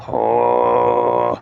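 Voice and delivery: deep throat-singing growl with false folds; low subharmonic drone. Shown as a demonstration. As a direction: drop into a guttural drone an octave down. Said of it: "false folds vibrating".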